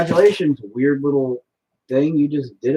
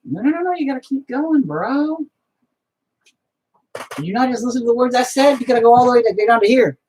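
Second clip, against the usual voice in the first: much higher, fussy telling-off; mock scolding; 9 semitones higher than usual. Painter scolding the paint for stopping short, high and fussy. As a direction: nag the brush like a kid.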